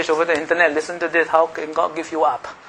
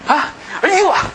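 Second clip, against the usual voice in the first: angry person; 9 semitones higher than usual. Retold or imitated. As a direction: act out a hothead threatening to sue. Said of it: "have you seen one angry person deal with another angry person?".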